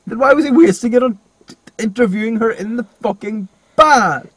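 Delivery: high, loud incredulous question; exasperated rant. Real, not acted. Challenging a co-host over the film's logic, exasperated. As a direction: raise pitch and volume in exasperation. Arguing about the bath scene.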